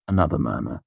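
ordinary delivery, nothing marked

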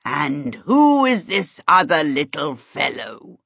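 slow, genial kingly question. A narrator's reading. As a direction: ask slowly, as a genial king. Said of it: "the king asked genially".

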